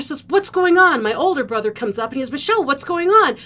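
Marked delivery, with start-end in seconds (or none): 0.3-1.0 loud, high questioning cry; urgent raised-voice question; alarmed brother. Retold or imitated. Brother reacting to choking sister. 2.3-3.4 high, loud urgent question; raised voice of alarm; alarmed older brother. Retold or imitated.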